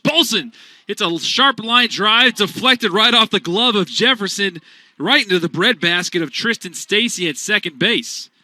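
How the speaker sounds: fading from a loud burst to an excited call; excited line drive call. Real, not acted. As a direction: ride the wild deflection with rising energy.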